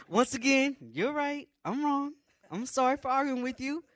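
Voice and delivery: high, loud contrite backtracking; hasty apology. Retold or imitated. Marcher apologizing to God for arguing, loud high-pitched contrition. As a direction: give in loudly, admitting fault.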